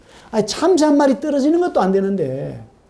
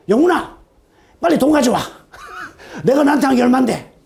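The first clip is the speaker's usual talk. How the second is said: laughing fatherly voice, higher; imitating father; 5 semitones higher than usual. Pastor imitating his father teasing about money. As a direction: play his old father, chuckling.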